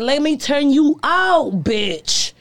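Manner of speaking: very slow, teasing drawl; seductive taunt. Retold or imitated. Bragging to the host about seducing men, slow teasing drawl. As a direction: stretch each word out like a dare. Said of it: "I'm the one that be like".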